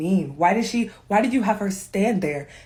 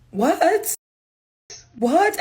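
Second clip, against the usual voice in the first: incredulous what; 9 semitones higher, 4 dB louder than usual. Real, not acted. Disbelief at the scene.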